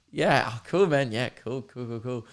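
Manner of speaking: soft, trailing-off agreement; imitating lukewarm, quiet approval; polite brush-off. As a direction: mumble along politely, fading away. Acts out friends' polite non-committal replies.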